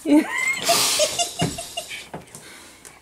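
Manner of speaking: loud, high giggle tailing off; high laughing fit. Real, not acted. Wife at naked husband, helpless high laughter. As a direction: burst out laughing high, then fade.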